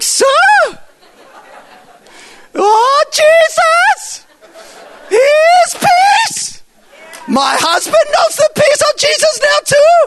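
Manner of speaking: very high, loud, drawn-out mimicked screech; ecstatic shrieking. Cashier hailing the preacher across the supermarket, ecstatic shriek. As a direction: mimic her hollering joy across the shop, words stretched.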